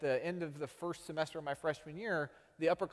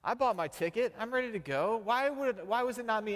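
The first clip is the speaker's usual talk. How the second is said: jealous whine; 9 semitones higher, 4 dB louder than usual. Retold or imitated. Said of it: "Or is our initial response".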